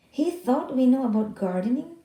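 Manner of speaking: mild anger. Acted. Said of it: "His Spanish was fast and angry".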